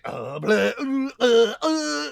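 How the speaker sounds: broken stammering uh sounds rising high; glitching out. Retold or imitated. Husband glitching out while his wife calls for help. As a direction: stall and stutter like a frozen man. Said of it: "like, glitching out".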